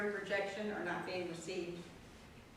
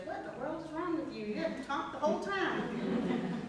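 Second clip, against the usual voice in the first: quick, higher-pitched mock husband, half-teasing; husband's baffled question; 7 semitones higher than usual. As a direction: toss it off like a puzzled husband poking fun. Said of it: "he goes".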